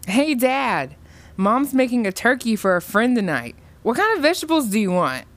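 perky and pitched well up; chipper greeting. A narrator's reading. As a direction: burst in with happy news. Skips in, greets dad cheerfully.

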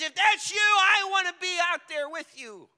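loud, high, eager cry; bold shout. Acted. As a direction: shout it over wind and thunder. Peter shouts to Jesus again.

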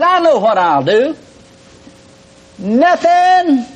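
retold holler, climbing to a high shout on Nothing; hollered out loud. Alone in the house, answering his nagging mind with a loud holler. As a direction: throw the line out loud, the last word way up high. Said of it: "so I just hollered out loud".

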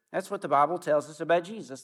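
ordinary talk

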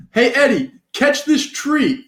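high-pitched mock dad holler; calling out. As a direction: call it out like a dad across the yard, stretched words. Acted dad calling out to Eddie.

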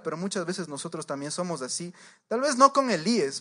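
normal talking voice

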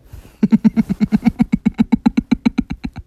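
long loud laughing fit. Real, not acted. Kicking the date out, loud laughing fit. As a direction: crack up loudly.

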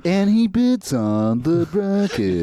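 high, slow, droning narrator; drawn-out singsong narration; Chills. Retold or imitated. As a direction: parody a YouTube countdown narrator. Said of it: "it's like Chills".

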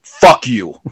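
firm, deliberate, no laugh in it; mean it, as an imitated insult; venomous. Shown as a demonstration. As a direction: curse with rage behind it, imitated. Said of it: "some rage and some passion behind that".

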